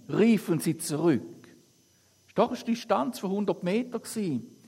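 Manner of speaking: lively, lightly acted Bible reading to the congregation; scripture reading. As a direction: read the Gospel aloud with the quotes lightly voiced. Reads Bible passage aloud. (lebhafte Bibellesung)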